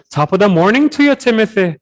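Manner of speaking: high, hammed-up Irish brogue; Irish accent impression. Mimicking an Irish examiner greeting a student, hammed-up Irish accent. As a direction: play a cheery Irish examiner, lilting Irish accent. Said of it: "a Scottish, uh, teacher or an Irish teacher".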